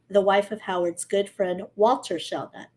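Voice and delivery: usual speaking voice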